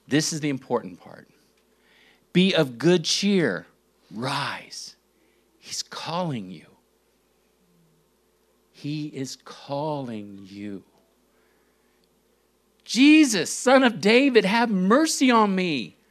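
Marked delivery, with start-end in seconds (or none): 2.3-3.7 calling out. Acted. Crowd calls the blind man. 4.1-6.7 whispered, hushed and urgent; a secret nudge passed in a whisper. Acted. Crowd urges the blind man. 12.8-16.0 high, loud pleading shout; a blind beggar shouting for Jesus; desperate cry. Acted.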